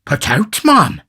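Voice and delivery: high, loud, indignant echo; shocked exclamation. A narrator's reading. Beadle echoes the upset matron, shocked exclamation. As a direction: exclaim it back, leaping up on the last word. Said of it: "exclaimed Mister Bumble".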